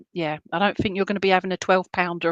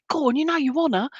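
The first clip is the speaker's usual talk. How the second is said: higher-pitched teasing encouragement; playful egging on; 7 semitones higher than usual. Retold or imitated. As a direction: egg them on cheekily, pitched up. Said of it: "he's laughing, he's saying".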